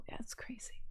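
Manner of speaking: breathy unvoiced whisper, dropping almost to nothing. Real, not acted. Streamer hushing to chat about the killer, whispered. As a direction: hush it like a secret you shouldn't say.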